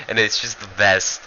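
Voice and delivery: ordinary talk, nothing marked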